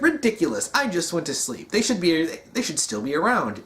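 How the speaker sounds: high-pitched, mock-indignant AI; Exuberant Witness. Voices monitor refusing to believe news.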